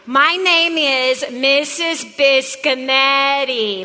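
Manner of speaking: slow and deliberate name; slow, drawn out. Shown as a demonstration. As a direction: spell out your name slowly. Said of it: "Let's try that together".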